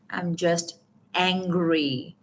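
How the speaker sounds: slow, pausing before a drawn-out angry; stress on angry. Shown as a demonstration. Coach answers her own question, stress on angry. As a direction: stretch and weigh the word angry. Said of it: "I have stressed on the word anger here".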